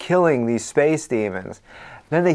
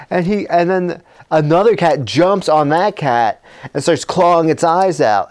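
ordinary talk

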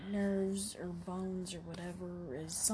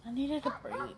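"I needed a break" repeated much higher; 7 semitones higher than usual. Shown as a demonstration. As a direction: say "I needed a break" again, pitched well up. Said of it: "What do you need?".

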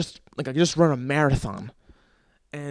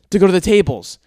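ordinary delivery

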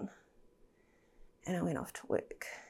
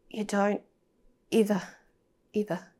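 first "ever" pushed much louder, second "ever" less so; stress on ever; 2 semitones higher, 7 dB louder than usual. Real, not acted. Telling the host about the moment she found him: "ever" pressed hard and loud. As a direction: lean hard on "ever", then ease off.

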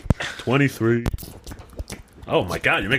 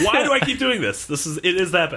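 exasperated; 7 semitones higher than usual. Retold or imitated. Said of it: "every year I'm like".

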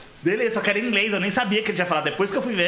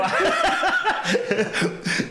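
burst of laughter; 12 semitones higher than usual. Real, not acted. (gargalhada alta)

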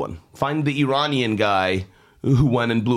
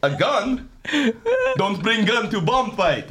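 higher, broken English in a put-on accent; 11 semitones higher than usual. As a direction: drop the articles in a mock accent. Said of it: "In the accent, all right".

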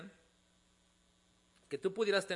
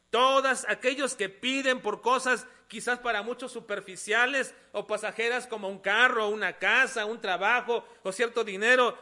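loud, raised, sustained pulpit voice; forceful preaching; 6 semitones higher, 12 dB louder than usual. Real, not acted. Preacher urging the congregation on a life of prayer, forcefully. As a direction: build insistent lists, stretching the key words.

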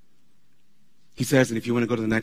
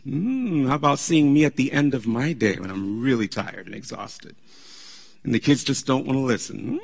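usual speaking voice

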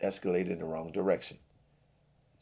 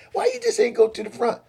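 much higher, loud outraged question; indignant squawk; 9 dB louder than usual. Acted. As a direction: squeal out the injustice. Billy's indignant rant.

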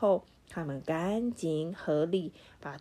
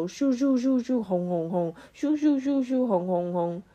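whooshing vacuum noises; 5 semitones higher, 4 dB louder than usual. A narrator's reading.

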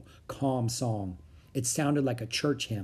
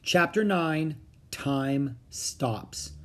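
ordinary delivery, nothing marked

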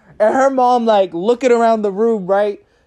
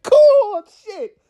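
squeaky cool; 11 semitones higher than usual. Real, not acted. Punchline reaction.

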